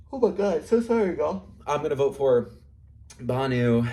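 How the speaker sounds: mock gushing sympathy. The other two tell Banu they are sorry, in mock gushing sympathy. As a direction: overdo the fake apology, bright and loud.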